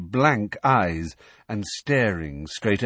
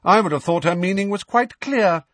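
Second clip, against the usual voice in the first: harsh; 9 semitones higher, 5 dB louder than usual. A narrator's reading. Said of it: "McGonagall harshly".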